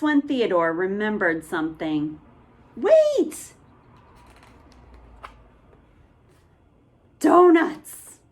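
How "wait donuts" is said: high, loud eureka; excited shout. Acted. Theodore remembering donuts, excited shout. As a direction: shout the idea. Boy's idea.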